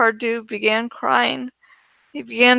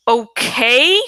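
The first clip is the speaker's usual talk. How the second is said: louder, indignant echo of the question; loud angry; 5 dB louder than usual. A narrator's reading. Teacher snapping back at Prince Jake's question, angry. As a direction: throw the word back angrily. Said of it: "in a loud, angry voice".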